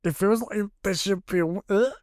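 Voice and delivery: words squeezed as if something grips the throat; strangled voice. Retold or imitated. Voice changing as if being strangled by a ghost, choked and high. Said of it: "my voice was like".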